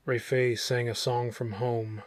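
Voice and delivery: usual speaking voice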